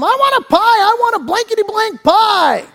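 put-on high-pitched bratty kid yelling at mom; imitated yelling kid. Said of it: "now he's yelling at his mom".

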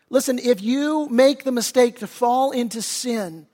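ordinary talk, nothing marked

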